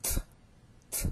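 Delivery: "T, t" as separate puffs of air; tongue behind teeth, release each "T" with air; two voiceless aspirated bursts of "T". Shown as a demonstration. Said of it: "you aspirate".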